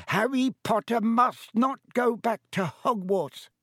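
high-pitched, insistent little elf voice; earnest house-elf Dobby. Acted. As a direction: warn him urgently in a high elf voice.

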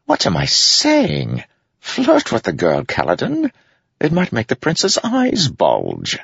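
high, slow mocking tease; playfully egging him on; teasing. A narrator's reading. Wit reverses, teasing Kaladin.

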